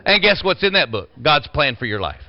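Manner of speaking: ordinary talk, nothing marked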